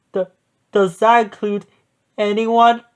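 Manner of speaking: a touch of high-pitched fear; nervous stammer. Acted. Scared goat asks if he is food.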